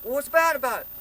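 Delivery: high, loud demand; acted-out exasperated yell; retold shouted challenge. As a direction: argue back at the heckler. Acted shouting match with heckler.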